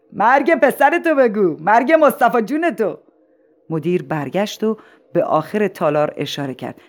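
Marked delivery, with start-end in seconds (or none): 0.1-3.0 high yell from the back of the hall; shouted heckle. Acted. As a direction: shout the demand, stretching the words. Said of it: "از ته تالار فریاد زد". (فریاد از ته تالار)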